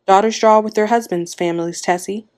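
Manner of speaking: ordinary delivery, nothing marked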